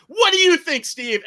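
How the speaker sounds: high, loud imitation of an angry girlfriend; mock confrontational demand. Girlfriend dragging friend into argument, high mock demand. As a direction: pounce on him for his opinion. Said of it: "She's ripping into him".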